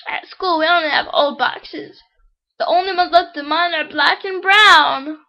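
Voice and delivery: put-on high, loud child's voice; little Johnny. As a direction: explain it as an eager little boy.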